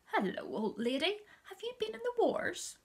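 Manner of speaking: father teasing. A narrator's reading. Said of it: "He said".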